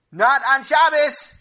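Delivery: long high yelled protest; shouted. Acted. Sammy jumping up in shul to stop the Rav, shouting. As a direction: blurt it out at full voice, dragging it. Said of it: "and Sammy shouted".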